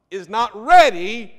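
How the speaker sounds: "is not ready" hit loud and slow; emphatic preaching. Real, not acted. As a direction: punch "not ready" to the back row. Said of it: "preacher punches key phrase".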